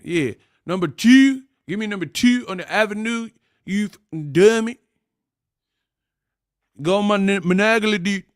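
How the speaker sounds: high, exaggerated Baltimore vowels; Baltimore accent. Customer in a Baltimore barbershop, high mock Baltimore accent. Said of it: "you don't know what they talking about".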